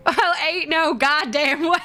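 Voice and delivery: high, laughing Southern twang; Southern accent. Pretend. Streamer showing off the accent for chat, hammed-up Southern accent. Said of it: "I will use Southern accent if".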